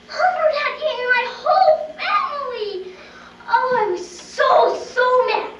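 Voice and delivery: furious outburst. Acted. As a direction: explode with childish fury. Whole family eaten, rage.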